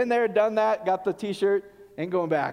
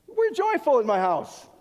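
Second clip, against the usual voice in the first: insistent; 6 semitones higher, 6 dB louder, slower than usual. Retold or imitated. Insisting to intruder.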